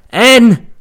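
loud high shout across the woods; calling out. Retold or imitated. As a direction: yell the missing boy's name. Search party calling out.

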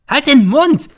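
very high, loud shriek; screeching. A narrator's reading. Mother silencing her son, screeching. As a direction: screech the order in fury. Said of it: "kreischt die Mutter".

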